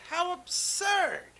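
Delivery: high, slow jeering voice; mocking yell. Animal friends taunting Horton, high jeering yell. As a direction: jeer at him like a schoolyard crowd. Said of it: "They yelled".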